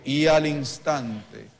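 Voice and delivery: y al instante stretched slowly and loudly, words set apart; slow, loud preacher's emphasis on y al instante; slow emphatic repeat. Shown as a demonstration. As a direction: drag out y al instante so the church can echo it. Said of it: "diga conmigo".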